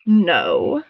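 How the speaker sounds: low, drawn-out frog voice; croaking frog queen. A narrator's reading. Frog queen answering a frightened moth, croaking. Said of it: "croaked the frog".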